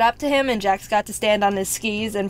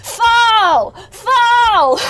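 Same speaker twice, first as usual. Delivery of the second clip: high, loud frantic yell; screaming; 10 semitones higher, 7 dB louder than usual. Retold or imitated. Dad screaming at the son sliding down, high loud yell. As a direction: shout it down the mountain in a panic. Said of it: "my dad's screaming".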